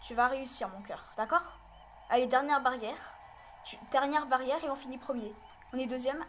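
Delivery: loud, eager coaxing of the dog; loud pleading encouragement to the racing dog; urging on. Real, not acted. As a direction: cheer her over the last hurdles. Urging dog on during race.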